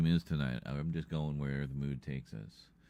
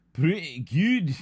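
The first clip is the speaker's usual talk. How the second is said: much higher, louder, slow, drawn-out Pretty good; hammed-up put-on voice; 11 semitones higher, 9 dB louder, much slower than usual. Streamer echoes his own verdict in a hammed-up slow voice. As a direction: stretch Pretty good out in a silly voice. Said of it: "that's pretty good".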